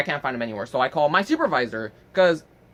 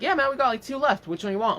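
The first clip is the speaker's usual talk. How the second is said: lightly voiced clerk, pitched higher; imitated shop clerk; 8 semitones higher than usual. Smoke shop dude answers a customer, imitated clerk voice. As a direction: play the easygoing clerk in a higher voice.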